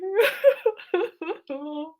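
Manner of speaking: high tearful laugh-sob; laughing cry. Real, not acted. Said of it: "I'm actually crying".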